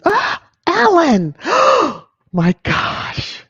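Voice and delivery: real high, loud gasping cry of surprise; floored by sudden generosity; overwhelmed surprise. Real, not acted. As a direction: gasp and cry out, stunned by a gift. Stunned by a big membership gift.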